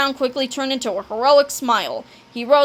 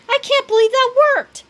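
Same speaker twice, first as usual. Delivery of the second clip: high, loud disbelief; excited cry; 12 semitones higher, 6 dB louder than usual. A narrator's reading. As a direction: yell it in thrilled amazement. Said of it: "cried Cheeseball".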